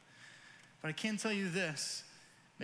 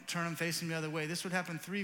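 ordinary delivery

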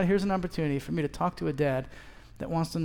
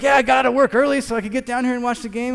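higher, louder eager small talk; chatty dad; 7 semitones higher, 7 dB louder than usual. Retold or imitated. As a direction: talkative dad on the bench. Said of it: "He's like".

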